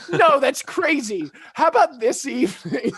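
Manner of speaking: loud, high, drawn-out mock refusal flipping to eagerness; hammed-up princess blurting an offer; overeager Aurora. Aurora answering Philip about tomorrow, mock overeager. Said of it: "And she goes".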